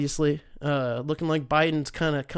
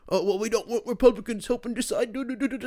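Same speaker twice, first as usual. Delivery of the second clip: high, silly voice mimicking officials; singsong mockery of a lame excuse; mocking imitation; 6 semitones higher than usual. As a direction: parody their excuse in a high voice.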